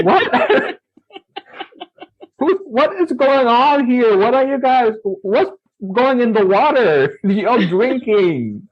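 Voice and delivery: high-pitched, laughing through the words; can't believe it, giggling as he asks; laughing disbelief. Retold or imitated. Boy to the classmates claiming he does magic, laughing disbelief.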